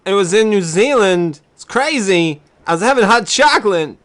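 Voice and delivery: hammy New Zealand tourist voice; New Zealand accent. Pretend. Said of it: "not a very good New Zealand accent".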